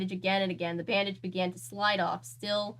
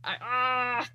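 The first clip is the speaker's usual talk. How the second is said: overwhelmed exclamation; 4 semitones higher, 3 dB louder than usual. Real, not acted. Reader overcome by story.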